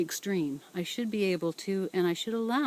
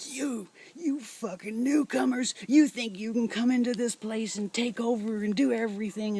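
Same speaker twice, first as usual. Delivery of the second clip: higher-pitched mock outburst at a newcomer; retelling the neighbor's angry rant in a put-on higher voice; imitating angry neighbor; 4 semitones higher than usual. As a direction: spit out "fucking newcomers" in a hammed-up sneer. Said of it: "she was just very angry".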